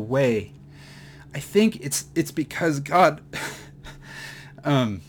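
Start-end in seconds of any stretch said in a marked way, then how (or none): 1.3-5.0 high, breaking voice, crying laugh; overcome with emotion. Real, not acted. Preacher overwhelmed by God's love, crying. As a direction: voice cracking as tears come. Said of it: "had a real moment there".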